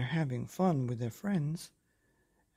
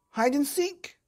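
high, loud eager suggestion; excited dinosaur; 12 semitones higher, 6 dB louder than usual. Acted. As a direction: pipe up with a bright idea.

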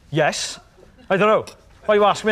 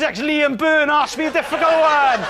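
usual speaking voice

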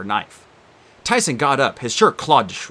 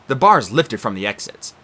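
ordinary delivery, nothing marked

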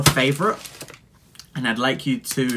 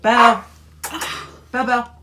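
calling out; 12 semitones higher, 6 dB louder, faster than usual. Real, not acted. Calling the dog.

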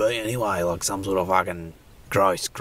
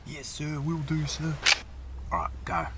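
much higher and softer, meek servant creature; 8 semitones higher, 6 dB quieter than usual. Acted. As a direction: answer like a timid minion. Servant creature, soft high.

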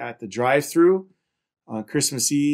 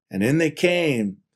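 ordinary delivery

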